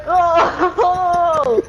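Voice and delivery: high oh breaking into a laugh. Retold or imitated. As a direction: laugh it out, amused. Mom laughing at it.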